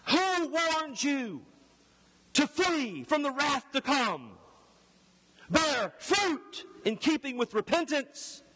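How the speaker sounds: high, forceful declamation of scripture; loud preaching. Acted. Herald warning the crowds of wrath, loud and forceful. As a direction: thunder it out as a stern warning. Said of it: "I'm gonna have to be loud".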